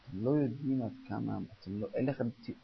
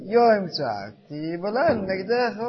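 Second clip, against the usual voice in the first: slow, drawn-out declaration with raised voice; said contentedly, as statement; 6 semitones higher, 7 dB louder, slower than usual. Acted. Rabbi showing how people now say the verse complacently, drawn-out statement. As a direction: stretch it out as a settled fact. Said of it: "אומרים את זה בנחוסי".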